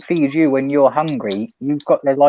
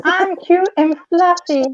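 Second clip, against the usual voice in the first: cute voice; 13 semitones higher, slower than usual. Pretend. Playing innocent cute creature.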